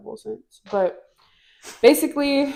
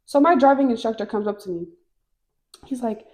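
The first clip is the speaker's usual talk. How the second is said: ordinary talk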